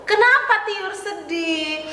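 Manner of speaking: ordinary delivery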